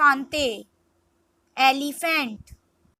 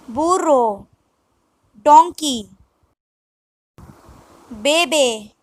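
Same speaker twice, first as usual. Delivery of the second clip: normal talking voice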